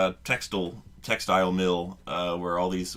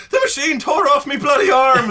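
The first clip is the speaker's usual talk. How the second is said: put-on much higher, loud Irish-accented wail; Irish accent scream; 11 dB louder than usual. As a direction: shriek it in a broad Irish accent, mock agony. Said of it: "an Irish accent saying".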